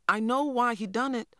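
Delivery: put-on high girl's voice, earnest; a child speaking up; young girl. A narrator's reading. Girl tells the teacher why her brother did it, earnest.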